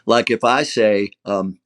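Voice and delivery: ordinary delivery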